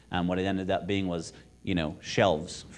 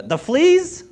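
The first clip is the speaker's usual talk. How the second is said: high, loud incredulous shriek; outraged disbelief; 12 dB louder than usual. A narrator's reading. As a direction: burst out aghast at the fleas. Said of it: "This was too much".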